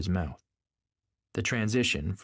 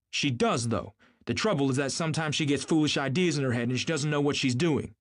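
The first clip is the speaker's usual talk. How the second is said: higher-pitched, emphatic argument; insistent; 7 semitones higher, 3 dB louder than usual. A narrator's reading. Tom insisting Daisy loves him, insistent. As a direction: press the point firmly, voice raised in pitch.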